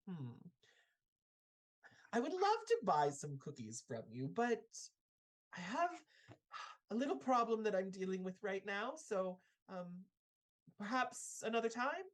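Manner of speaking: high, quick, sugary voice; sweet old lady. Acted. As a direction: politely put the children off. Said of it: "she looks at you all and she says".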